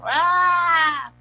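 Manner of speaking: high mock scream of a child; a short high yelp of pain; child's scream. Pastor imitates a boy's cry at the injection, high and sharp. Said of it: "el grito del niño".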